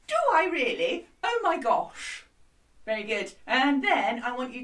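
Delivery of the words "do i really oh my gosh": a bit higher posh lady; lightly posh exclamation. Shown as a demonstration. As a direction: put on a posh voice. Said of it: "Can you do it?".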